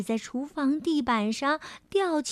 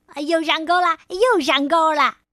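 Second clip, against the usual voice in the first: louder, higher, gleeful cheering; happy shout; 4 semitones higher, 7 dB louder than usual. Acted. Cat playing fishing on the floor, mock-excited louder cheer. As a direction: cheer as if the fish really bites. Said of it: "高兴地喊着".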